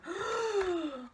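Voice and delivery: sharp gasp; a high gasp of shock. Real, not acted. Reacting to her diary.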